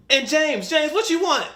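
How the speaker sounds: loud, high-pitched mom calling out; mother calling kids. Retold or imitated. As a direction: imitate a mother hollering for her kid's order. Mother calling kids in retold story.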